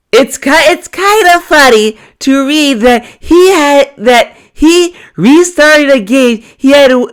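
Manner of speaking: high, put-on read-out voice. Streamer reading a viewer's comment on DSP, high put-on voice.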